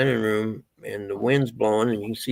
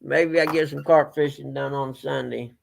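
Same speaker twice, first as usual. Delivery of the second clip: normal talking voice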